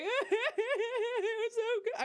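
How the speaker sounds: blubbering it was so good; teary gush. Retold or imitated. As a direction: gush like you're crying at the finale. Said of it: "I was ugly crying".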